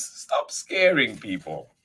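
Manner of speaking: usual speaking voice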